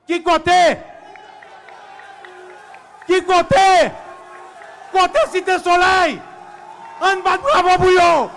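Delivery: full-voiced, high crowd call; rally shout. Real, not acted. Speaker hailing Cité Soleil and demanding applause, shouting.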